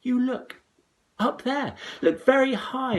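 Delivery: put-on high, loud eager boy. A narrator's reading. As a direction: point up excitedly. Jack pointing up.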